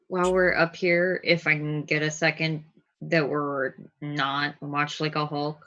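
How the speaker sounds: ordinary talk, nothing marked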